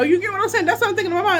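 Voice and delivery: usual speaking voice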